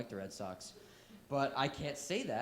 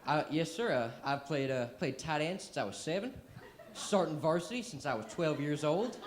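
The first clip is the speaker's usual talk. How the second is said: fake Southern accent; 4 dB louder than usual. Student answering a Southern coach in his own accent. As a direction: play along in a Southern accent, sir-ing him. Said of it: "gotta stay in character".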